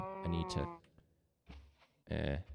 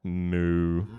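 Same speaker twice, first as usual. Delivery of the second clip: low, drawn-out moo; long low imitation cow; 10 semitones lower than usual. Shown as a demonstration. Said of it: "And a cow-sized cow".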